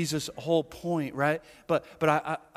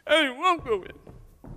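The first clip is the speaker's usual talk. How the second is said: put-on slow, high whiny child refusing; sulky kid; 11 semitones higher, 3 dB louder, slower than usual. Older son throwing a tantrum at his father, high whiny voice. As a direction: pout it out like a stubborn child. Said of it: "a little kid having a temper tantrum".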